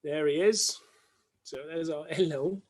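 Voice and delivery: ordinary talk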